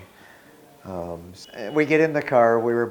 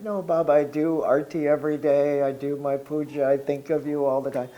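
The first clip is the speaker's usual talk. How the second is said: pleading protest; 6 semitones higher, 4 dB louder than usual. Retold or imitated. Pleading with crying Baba in dream.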